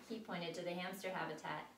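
plain reading voice

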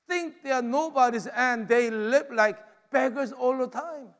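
ordinary talk, nothing marked

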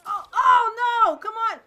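loud, high, drawn-out protest; real pleading yell; dismayed outcry. Real, not acted. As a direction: cry out in dismay, slowly. Dismayed by mishap in game.

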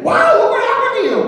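high, wide-eyed wow; amazed deaf friend. Retold or imitated. Deaf friend voiced as amazed, not rude. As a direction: marvel at the change, not insulting.

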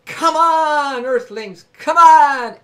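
loud rallying call; rousing shout. Real, not acted. Streamer calling out to his viewers, loud. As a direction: shout it to wake them up.

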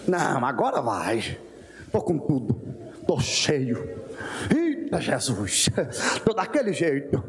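lightly voiced boastful believer, low and cocky; a hint of a swaggering churchgoer. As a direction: brag like someone who feels unstoppable. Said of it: "a gente acorda inspirado e diz".

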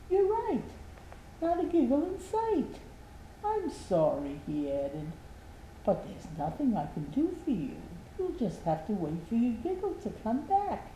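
a doctor breaking bad news kindly.

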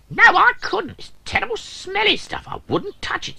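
very high squeaky refusal; disgusted drill. Acted. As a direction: recoil from the smell.

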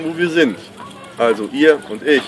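ordinary talk

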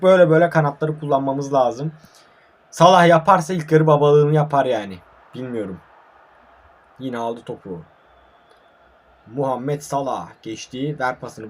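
ordinary talk, nothing marked

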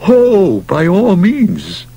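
high, slow emphatic yes; eager agreement. Acted. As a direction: gush it out slowly. Stillman eager reply.

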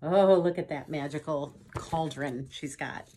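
usual speaking voice

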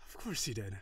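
ordinary talk

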